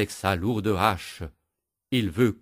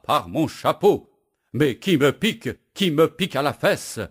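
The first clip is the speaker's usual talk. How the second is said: higher, louder indignant yell; 6 semitones higher, 5 dB louder than usual. Acted. Stung woodcutter yells at the bee, louder and higher. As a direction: howl at the sting. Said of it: "le bûcheron hurle".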